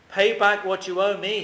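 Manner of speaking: the cruel servant demands payment, lightly acted and forceful; demanding. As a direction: press him hard to pay up. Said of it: "he demanded".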